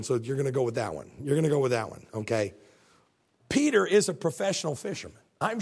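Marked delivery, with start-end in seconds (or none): 0.0-2.5 unimpressed. Acted. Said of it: "I can just see Jesus going".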